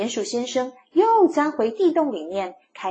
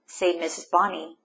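usual speaking voice

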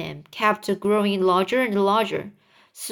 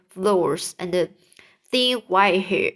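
plain reading voice

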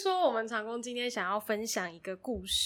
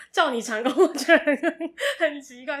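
laughing; 5 semitones higher, 9 dB louder than usual. Real, not acted. Laughing at being called 长工.

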